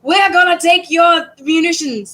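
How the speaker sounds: high-pitched, hammed-up British accent; bad British accent. Teacher imitates yelling Redcoats for class, bad British accent. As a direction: put on a clumsy British accent and call out. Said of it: "we need the accent".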